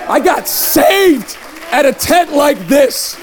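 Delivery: high, slow proclamation; emphatic testimony. Real, not acted. Preacher declaring he was saved in a tent, emphatic.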